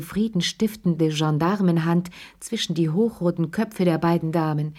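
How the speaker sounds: usual speaking voice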